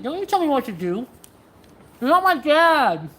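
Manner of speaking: mock defiance. Mock defiance toward bossy chat.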